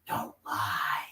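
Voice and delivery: low, hushed ghostly voice; quiet, drawn-out mock spirit voice; imitated disembodied voice. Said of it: "the same voice say".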